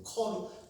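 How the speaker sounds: 커로 with an aspirated k instead of 그; showing how 그 becomes 커; Gyeongsang 커로. Shown as a demonstration. 경상도 발음 시범. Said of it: "커로 발음한다는 거예요".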